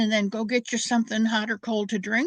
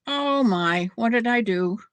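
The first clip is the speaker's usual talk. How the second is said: ordinary talk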